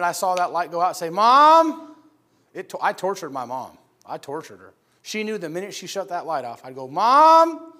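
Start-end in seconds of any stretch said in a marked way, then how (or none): 1.1-2.0 put-on loud, high, drawn-out child's yell; calling out for Mom. Eight-year-old to his mom when the light goes out, calling out. 6.9-7.7 put-on loud, high, drawn-out child's yell; calling out for Mom. Eight-year-old to his mom when the light goes out, calling out.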